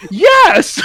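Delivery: high, loud squeaky yes; mock cricket shouting yes; emphatic yes. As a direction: blurt it like an outraged cricket.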